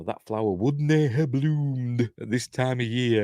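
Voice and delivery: put-on slow, drawn-out Scottish accent; put-on Scottish voice, words stretched out. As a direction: quote him in a broad Scottish accent. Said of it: "That's my Scottish accent".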